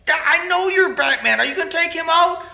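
usual speaking voice

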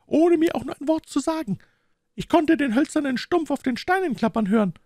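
high-pitched, animated woman's character voice; boatman's wife recounting. A narrator's reading. Wife recounts the night visit to the detective, high insistent voice.

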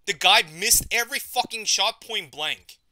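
loud, indignant complaint; loud rant. Real, not acted. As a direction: vent it loudly. Raging about dying to bot.

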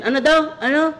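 high, baffled asking what was said; confused Pinoy superhero. Retold or imitated. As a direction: play a puzzled hero who missed the order.

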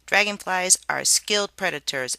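usual speaking voice

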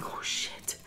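breathy whispered "Oh shit" under her breath; retold whisper. Hearing the fight outside, whispered to herself. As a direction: hush it as if not to be heard.

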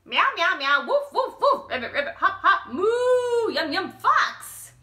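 high, loud animal noise barrage; called out loud. Acted. Bob the rooster yelling animal noises at the fox. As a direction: scare the fox off with every sound. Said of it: "Bob called out aloud".

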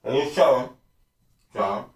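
counting through a packed mouth; mushed words round carrots; cheeks stuffed full. Real, not acted. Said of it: "put a bunch in".

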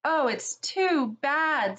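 slow, high tearful lament; sobbing. A narrator's reading. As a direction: grieve through the words. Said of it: "sobbed Lucy".

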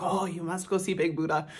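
a hint of a Thai local urging; mock Thai accent. Local man urging a tourist to sightsee, light mock Thai accent. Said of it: "go into a bit of an accent".

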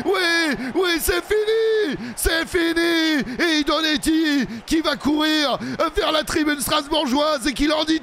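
very high, shouted euphoric commentary; wild triumphant play-by-play; ecstatic victory shout. Real, not acted. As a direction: scream the win to the crowd. Winning the shootout.

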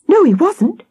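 high-pitched, voiced alarm; horrified whisper. A narrator's reading. Victoria pointing to the woods, high and horrified. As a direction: squeak it out in horror. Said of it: "she whispered".

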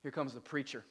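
low, soft remark to a helper; mock a neighbor's muted warning; dismissive aside.

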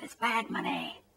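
fading mock protest, almost under the breath; muttered aside. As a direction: mumble it as the outrage fizzles out. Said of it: "are all like".

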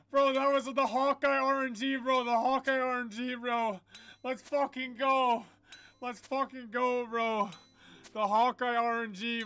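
high, hyped gamer talk; excited celebration. Real, not acted. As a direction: keep riding the win. Celebrating rare drop luck.

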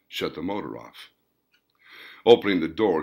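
ordinary delivery, nothing marked

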